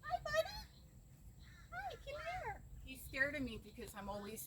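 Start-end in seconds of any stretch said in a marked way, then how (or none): 0.0-2.6 very high, soft coaxing; soft pet talk. Real, not acted. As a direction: gently lure a shy cat closer. Coaxing shy cat closer.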